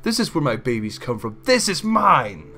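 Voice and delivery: loud, high, slowing furious claim; possessive fury. Acted. Wife angrily claiming her husband before the crowd. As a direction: stake your claim fiercely. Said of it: "The angry mare".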